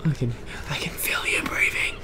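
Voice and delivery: creepy breathy whisper. Pretend. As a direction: breathe it close like a stalker.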